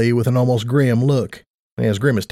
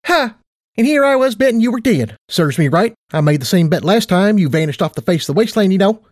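much higher, perky affectionate mare; perky Tight Lips voice; 7 semitones higher than usual. Acted. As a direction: play a bubbly casino boss.

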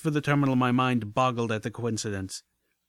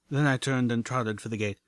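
ordinary delivery

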